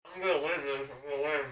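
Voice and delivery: garbled through stuffed cheeks; mouth full. Real, not acted. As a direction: talk around a mouthful. Mouth still stuffed, garbled words.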